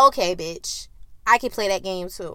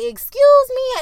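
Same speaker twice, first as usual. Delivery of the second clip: much higher, loud outraged excuse me; indignant; 14 semitones higher, 9 dB louder, slower than usual. Retold or imitated. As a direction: shriek it in disbelief. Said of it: "So I'm like".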